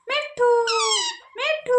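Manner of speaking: high singsong name-call; coaxing a parrot. Shown as a demonstration. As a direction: sing the name out high for a bird to copy. Models name for parrot to repeat. (तोते को ऊँचे सुर में पुकारना)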